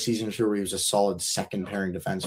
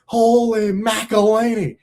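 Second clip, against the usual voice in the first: high, slow excited announcer call; Bowen; 10 semitones higher, 5 dB louder, slower than usual. Retold or imitated. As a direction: belt it like a thrilled play-by-play man. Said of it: "Bowen's like".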